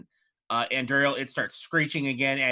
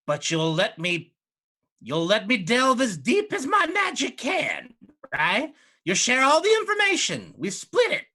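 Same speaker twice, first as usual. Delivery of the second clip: high, louder pleading witch; eager hag; 10 semitones higher, 5 dB louder than usual. Acted. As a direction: push hungrily for the deal. GM voices Maggie bargaining.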